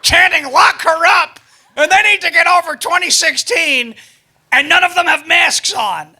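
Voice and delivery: mock-outraged high, loud tweet reading; hammed-up high-pitched alarm; Chelsea Clinton. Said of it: "Chelsea Clinton tweeted".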